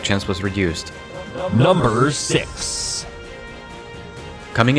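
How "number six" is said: slow, high announcer call with a rise; top ten countdown. Acted. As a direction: stretch it out like a game-show host. Top ten list countdown card.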